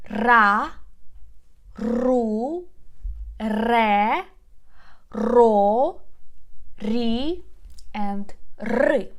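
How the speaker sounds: ra, ru, re, ro, ri each loud with a rolled r; trilled r syllables. Shown as a demonstration. Teacher drills students on r syllables. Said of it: "One more time".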